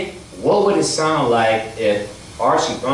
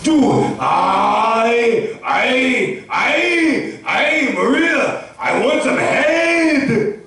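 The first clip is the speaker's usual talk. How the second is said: higher, slow, stammering mock Austrian voice; Arnold Schwarzenegger; 12 semitones higher, much slower than usual. Arnold asking Maria for head, high slow mock Austrian. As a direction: stutter it out, hammed-up Arnold. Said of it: "It'd be like this".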